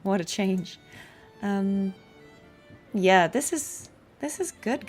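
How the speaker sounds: normal talking voice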